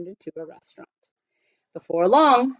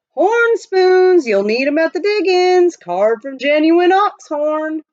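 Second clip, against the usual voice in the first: much higher, louder hawker's call; peddler crying wares; 9 semitones higher, 7 dB louder than usual. A narrator's reading. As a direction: bellow the wares across the wharf.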